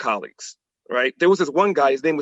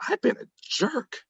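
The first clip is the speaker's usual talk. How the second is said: soft, higher confession of guilt; quiet confession; 7 semitones higher, 7 dB quieter than usual. Retold or imitated. Staring in the rear view mirror, quietly admitting his fault. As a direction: admit it softly to yourself. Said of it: "And I said".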